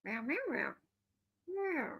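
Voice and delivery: playful cat meows, the last one pitched high; cat meowing. Retold or imitated. Streamer voicing the secretary cat to its friends, meowing. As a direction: meow like a chatty office cat. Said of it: "and goes".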